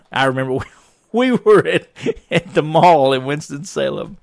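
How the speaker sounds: laughing through the start of the story; chuckling as the memory comes back. Real, not acted. Telling a funny family story while laughing.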